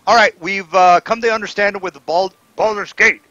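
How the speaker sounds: high, loud orc voice; orc leader. Acted. Orc starting the introductions, loud leader's voice. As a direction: take charge loudly as the orc. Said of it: "the orc says".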